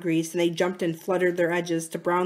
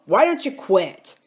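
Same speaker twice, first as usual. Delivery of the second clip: louder, irritated outburst; narrated shout; 2 semitones higher, 6 dB louder than usual. A narrator's reading. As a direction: raise your voice in a restrained shout. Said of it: "Charles shouted at him".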